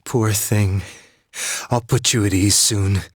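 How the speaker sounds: eerie distorted voice. Acted. Mysterious voice on the Midnight Channel.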